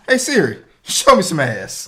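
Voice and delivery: calling to Siri. Pretend. Commanding phone assistant jokingly.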